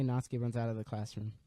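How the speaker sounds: usual speaking voice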